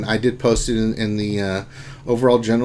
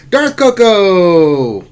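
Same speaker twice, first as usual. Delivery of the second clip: drawn-out name call; 6 semitones higher, 7 dB louder, much slower than usual. Acted. Drawing out a chatter's name.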